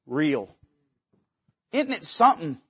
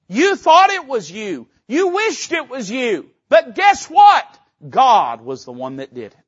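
Joseph's bold reply; 4 semitones higher, 7 dB louder than usual. Acted. Preacher voices Joseph to brothers.

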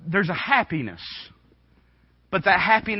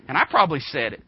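ordinary talk

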